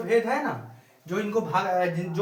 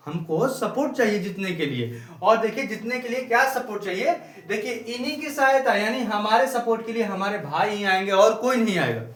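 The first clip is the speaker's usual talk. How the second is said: normal talking voice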